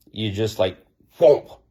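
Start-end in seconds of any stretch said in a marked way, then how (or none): 1.2-1.6 sudden loud, higher-pitched whomp; punchy whomp. Shown as a demonstration. Cutting through someone's hysterical energy, punchy whomp. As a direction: punch it out like a blow. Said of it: "It's kinda like shouting to somebody".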